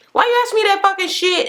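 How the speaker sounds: imitated high-pitched outrage; going off. Daughter snapping at mother before hanging up, angry. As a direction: act out an indignant daughter. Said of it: "she went the fuck off".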